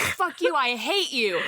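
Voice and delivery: high-pitched pretend tantrum; mock outburst. Acts out Nomi lashing out at Crystal.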